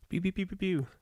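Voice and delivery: high, soft pew-pew blaster sounds; playful laser noises. Pretend. Fan playing at blaster fire mid-chat, playful laser noises. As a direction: imitate a toy blaster, high and quiet.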